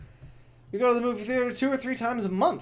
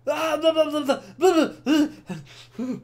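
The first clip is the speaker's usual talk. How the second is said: higher, louder sing-song blah; mocking blah blah; 8 semitones higher, 6 dB louder than usual. Mocking the anime confession, loud sing-song blah. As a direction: drown out the scene with sarcastic babble.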